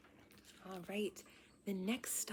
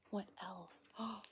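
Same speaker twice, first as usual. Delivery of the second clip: ordinary delivery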